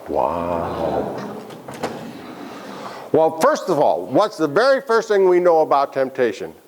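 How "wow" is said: low awed wow. Shown as a demonstration. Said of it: "Can we all say, "Wow"?".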